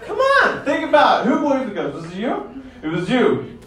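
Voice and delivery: normal talking voice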